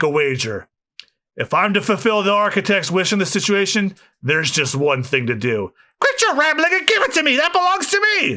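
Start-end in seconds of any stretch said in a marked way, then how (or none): 6.0-8.4 high, strained screaming villain voice; villain screech. Acted. Spandam screaming at Franky over the blueprint, high screech.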